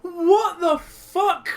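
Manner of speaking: very high, loud and slow; stunned outraged yell. Real, not acted. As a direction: drag it out in disbelief. Outraged reaction to the story.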